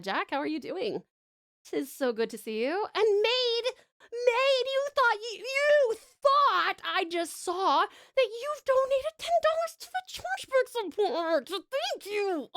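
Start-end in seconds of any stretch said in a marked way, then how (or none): 2.9-12.5 excited thanks. Real, not acted.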